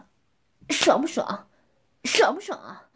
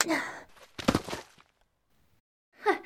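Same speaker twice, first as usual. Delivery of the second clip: ordinary talk, nothing marked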